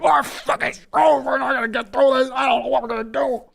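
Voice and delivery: high, strained, despairing mock Nolte; hammed-up Nick Nolte losing hope; Nolte impression. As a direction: yelp it out like a panicking Nolte. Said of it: "Nolte over there like".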